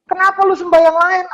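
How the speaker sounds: high-pitched indignant question; acted high, incredulous demand; indignant challenge. God challenging idol worshippers, acted.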